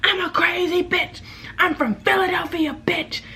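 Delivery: high, drawn-out mimicry of hallway ranting; mock unhinged boasting, pitched very high; going crazy. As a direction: imitate her raving in a squealed voice. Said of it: "hear her going crazy outside".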